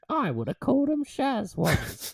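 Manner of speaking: put-on high, slow, British-tinged Aussie accent; Aussie Simpsons line, overdone. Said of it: "Simpsons Aussie accent".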